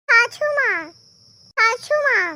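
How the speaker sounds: "छाछू माँ" with chh in place of s; lisped try. Acted. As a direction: lisp "छाछू" as chhaa-chhoo. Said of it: "तोतली बहू".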